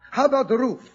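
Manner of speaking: ordinary delivery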